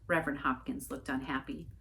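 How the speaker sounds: usual speaking voice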